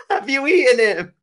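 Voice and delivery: high mock question through laughter; imitating the friend. Repeating the friend's question about Bippo, laughing high-pitched imitation. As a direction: squeeze the quote out while laughing. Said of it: "I remember you were like".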